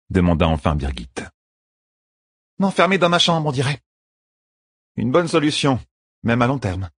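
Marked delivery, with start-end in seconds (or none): 2.6-6.9 higher, wry and bitter; sarcastic queen. A narrator's reading. Queen answers her champion sarcastically. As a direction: throw the answer back.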